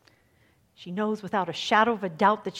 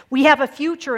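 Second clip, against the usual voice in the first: rousing proclamation; 6 semitones higher, 12 dB louder than usual. Real, not acted. Preaching climax.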